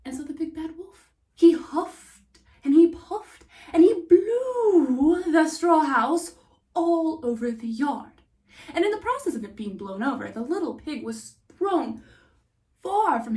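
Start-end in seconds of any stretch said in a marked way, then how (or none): 1.4-8.1 much louder, slow, blowing hard; storyteller acting out the wolf's blow, loud; huffing and puffing. A narrator's reading. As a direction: puff the words out like the wolf's breath. Narrating the wolf blowing.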